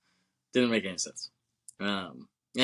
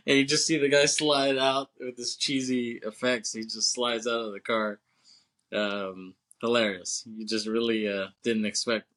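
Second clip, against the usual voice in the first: ordinary talk, nothing marked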